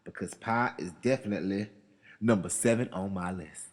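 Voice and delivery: usual speaking voice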